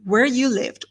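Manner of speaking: plain reading voice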